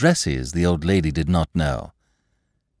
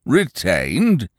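a scornful snort of a word, pitched up; snorting Sugg; 5 semitones higher than usual. A narrator's reading. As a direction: scoff at the word like a grumpy inspector. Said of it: "with a snort".

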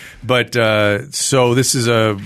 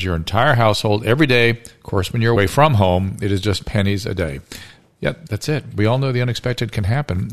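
smooth podcast ad read over music; easy, steady announcer read for the sponsor. Acted. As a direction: sell it like a host-read sponsor spot. Reads a sponsor ad.

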